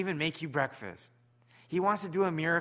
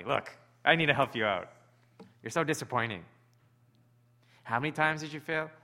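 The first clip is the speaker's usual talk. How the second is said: mock scolding; 4 dB louder than usual. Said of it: "Or is it gonna be like".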